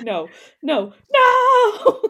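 rising to a high, loud wail; high loud mock wail; mock crying no. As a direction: wail it like a panicked mom. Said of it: "It's just like".

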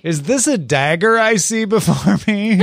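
laughing mock Shakespearean accent; hammy stage actor. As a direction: ham it up like a stage Macbeth. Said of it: "maintaining the accent".

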